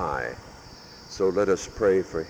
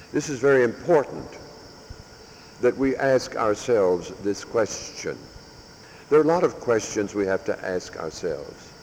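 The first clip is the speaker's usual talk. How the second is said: forceful preaching; 4 semitones higher than usual. Real, not acted. Priest urging his parish to examine their Lent, preaching. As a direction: preach it out to the pews. Sermon builds to full force.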